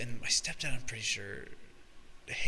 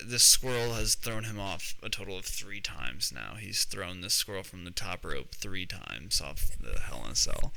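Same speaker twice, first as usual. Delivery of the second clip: ordinary talk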